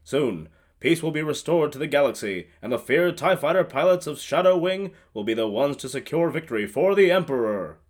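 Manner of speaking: loud, booming propaganda-reel announcer; grand opening-crawl narration; dramatic announcer. Acted. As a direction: proclaim the glorious victory to the galaxy. Reads opening crawl aloud.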